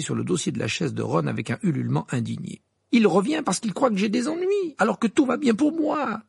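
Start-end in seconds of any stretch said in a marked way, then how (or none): 2.9-6.2 high, louder, agitated protest; angry outburst. A narrator's reading. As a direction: insist hotly that nothing is wrong. Harry furious about Sirius returning. (protestation emportée)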